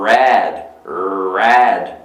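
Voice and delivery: "red" with a long growled English R; dog-like English R. Shown as a demonstration. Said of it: "tem um som meio que de cachorro".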